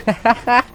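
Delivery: high-pitched staccato chuckle; quick, high burst of laughter. Real, not acted. Laughs at howitzer play.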